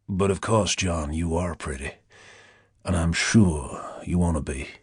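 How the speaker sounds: low, honeyed Deep South purr; languid Southern drawl. A narrator's reading. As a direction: purr it like a Southern plantation owner. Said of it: "languid southern drawl of a plantation owner".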